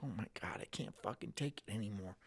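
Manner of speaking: broken, barely audible complaint; defeated mutter. Retold or imitated. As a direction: sigh it out like a man at the end of his rope. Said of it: "he's like".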